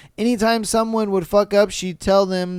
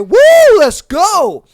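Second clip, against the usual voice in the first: much higher, louder whoop; mock cheering; 11 semitones higher, 10 dB louder, much slower than usual. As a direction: cheer like a proud mom. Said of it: "She was just like".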